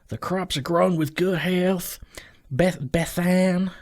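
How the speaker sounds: a lightly put-on half British, half American accent. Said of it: "half British, half general American accent".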